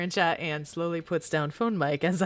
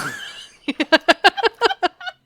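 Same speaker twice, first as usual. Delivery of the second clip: cracking-up laugh; 13 semitones higher, 8 dB louder than usual. Real, not acted. Laughs mid-song.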